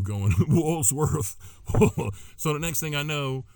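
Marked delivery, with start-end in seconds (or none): 0.5-1.4 Woolsworth echoed with the extra middle s; amused echo of Woolsworth with an added s; mimicked mispronunciation. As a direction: tease the word Woolsworth, keeping the s after Wools. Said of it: "Clooney and Ethan are sitting on the curb".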